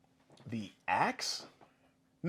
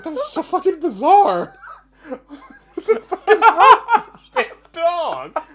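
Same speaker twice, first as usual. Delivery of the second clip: high, loud outburst while cracking up; helpless laughter; 14 dB louder, slower than usual. Retold or imitated. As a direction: barely get it out through big laughs. Retold reaction, in fits of laughter.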